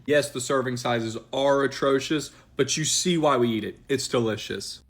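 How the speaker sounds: usual speaking voice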